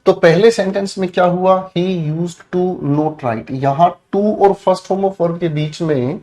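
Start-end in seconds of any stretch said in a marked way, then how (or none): 1.8-3.5 very slow, word by word; slow dictation. Shown as a demonstration. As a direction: spell it out slowly for the class. Reading the wrong sentence aloud.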